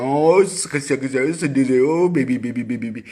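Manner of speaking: put-on high sing-song baby talk; soothing coo. Parent acting out comforting the crying child, cooing. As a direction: coo over a crying baby with exaggerated pity. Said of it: "kau sayang".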